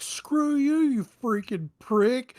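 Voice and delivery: loud high yell, slow on the insult; big booming voice. Pretend. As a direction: boom it out through the illusion. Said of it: "with a big booming voice".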